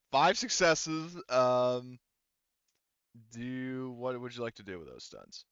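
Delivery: usual speaking voice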